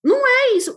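high, loud "Não é isso"; forceful repeated correction; emphatic denial. Real, not acted. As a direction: insist loudly, pitch jumping up.

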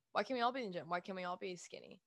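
ordinary talk

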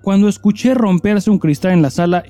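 steady storytelling read with some drama; story reading. Acted. Host reading a listener's story aloud, storytelling voice. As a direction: read it like a confession being told to listeners.

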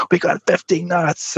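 high, drawn-out mock scream of joy; [name]. Crewmate celebrating a broken speed record, mock scream for joy. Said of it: "screaming for joy".

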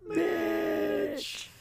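drawn-out high wail; high mournful call. Acted. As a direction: call out to the lost character. Calling out the name.

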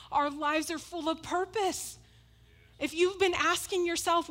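rally the congregation with rising fervour; full-force preaching. Acted. Pastor rallying the church about purpose, preaching hard.

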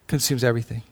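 quiet, hushed repeat of the phrase; soft echo. Acted. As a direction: let it sink in, almost under breath. Echoes his own phrase.

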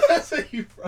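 high, loud laughing tease; teasing a friend about hometown. Real, not acted. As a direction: crack up while teasing.

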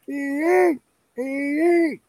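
thin, high "ee" screech, held; high-pitched animal call. Retold or imitated. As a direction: imitate the high calls heard in the woods. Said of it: "Very high pitched".

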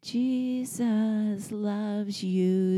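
put-on very slow, high, gentle child voice; child comforting grandma. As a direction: soothe her like a tender little boy. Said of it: "and he goes".

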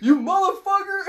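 high, loud mock screaming at the boyfriend; furious shout. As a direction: explode at the guy at the door. Acted dad catching the boyfriend.

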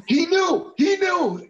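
high shouted insistence, falling hard; mock-indignant high yell; mock angry shout. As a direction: bellow it like an outraged lineman. Acted quote of angry player.

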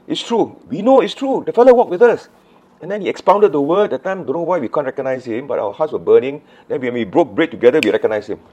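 high, loud, breathless-with-news insistence; excited insistence. Acted. Disciples convincing friends he walked with them, excited. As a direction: insist on it, starting very high, gradually settling.

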